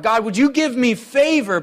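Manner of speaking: mock prayer for favor; asking God. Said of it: "we're asking God".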